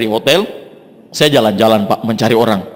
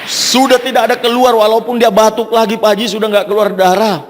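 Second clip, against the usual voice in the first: much higher, amazed report of the healing; excited good news; 7 semitones higher than usual. Retold or imitated. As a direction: tell it in disbelief and joy. Friend reports the miracle.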